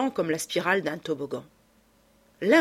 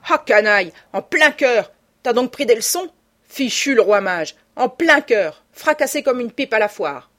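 higher, much louder mock outrage; loud exclamation; 5 semitones higher, 8 dB louder than usual. Lupin reacts to a gunshot that hit the painting, loud exclamation. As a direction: cry out as the rogue under fire.

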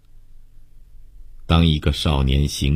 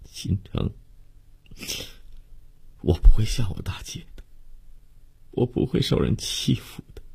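higher, partly breathy voice, holding back tears; tearful reassurance; 7 semitones higher, 4 dB quieter than usual. Acted. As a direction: gently promise a dying lover. Lover answers a tearful farewell. (含泪轻声安慰，气声偏高)

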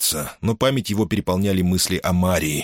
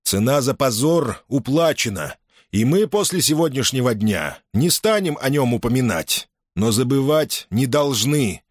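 addressing the troops; 6 semitones higher than usual. A narrator's reading. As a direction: make every soldier hear you. Julius continues addressing troops.

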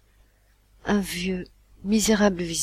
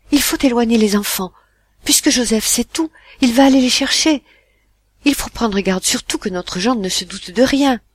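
louder, higher, urgent orders; brisk reply; 5 semitones higher, 9 dB louder than usual. Acted. Mother urgently telling husband what to do, brisk. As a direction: take charge fast and firmly. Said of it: "Elle répondit vivement".

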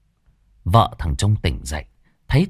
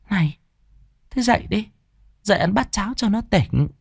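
wife coaxing; 12 semitones higher than usual. Acted. Wife coaxes husband to eat.

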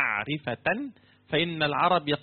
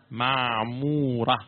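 slow repeat; 2 semitones lower, much slower than usual. Shown as a demonstration. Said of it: "تعرفون معمورة؟".

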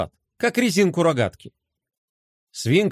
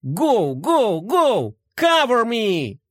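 action game soldier; 6 semitones higher, much slower than usual. A narrator's reading. Boy imitating English video game.

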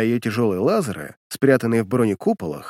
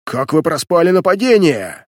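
angry yell; 5 semitones higher, 6 dB louder than usual. Acted. Коготь yells at crew.

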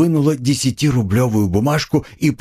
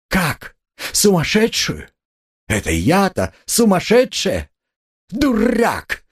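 high-pitched squeal of outrage; indignant shriek; 12 semitones higher, slower than usual. A narrator's reading. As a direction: screech it, deeply insulted. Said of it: "взвизгнула Катерина Ивановна". (возмущённый визг)

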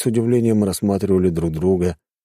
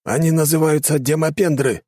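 higher ghost voice; 11 semitones higher than usual. A narrator's reading. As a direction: correct him as the ghost. Voices Ibragim character.

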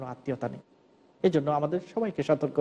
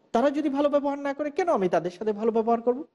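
higher-pitched, protesting rhetorical question; mock indignation; 7 semitones higher than usual. Preacher voicing relatives who refuse to return kindness, indignant. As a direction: voice a grudging objector, pitched up. Voices people refusing to be kind.

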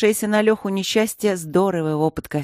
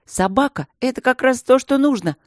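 high, loud excited cry; excited exclamation; 6 semitones higher, 5 dB louder than usual. Acted. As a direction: burst out with a sudden idea. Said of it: "воскликнул он".

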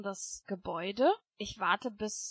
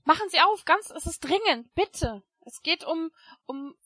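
desperate pleading; 6 semitones higher, 10 dB louder than usual. Acted. Pleading at the door.

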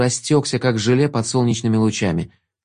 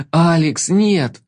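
frightened cry; 5 semitones higher, much slower than usual. A narrator's reading. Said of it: "испуганно схватился за голову и закричал".

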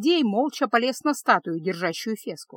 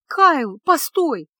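higher, louder desperate plea; pleading; 5 semitones higher, 7 dB louder, much slower than usual. A narrator's reading. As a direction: beg him to stop. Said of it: "взмолилась она". (отчаянная мольба, выше и громче)

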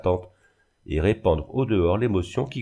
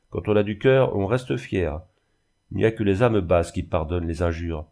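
ordinary delivery

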